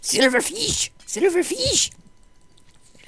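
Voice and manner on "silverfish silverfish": high squealing excitement; excited high calls. Real, not acted. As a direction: call it out with delight. Excited about mobs.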